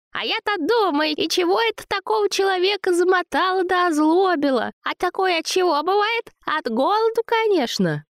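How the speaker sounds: very high, cooing flattery; babbling, fussing woman. Acted. Zina soothes angry Vova, high fawning babble. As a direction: coo and fuss to soothe him.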